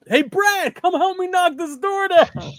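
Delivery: high, slow call across the room; calling a buddy over to break the door; calling to Brad. Retold or imitated. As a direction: hail a friend for help, drawn out. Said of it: "and then I'm like".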